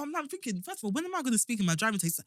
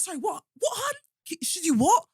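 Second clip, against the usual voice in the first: put-on Cockney accent; 8 semitones higher than usual. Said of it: "proper Cockney, like, driving instructor".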